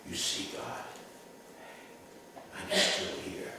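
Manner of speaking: soft hushed whisper to God; lonely survivor whispering alone in the synagogue. Retold or imitated. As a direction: breathe it up toward the ceiling. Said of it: "and he whispers".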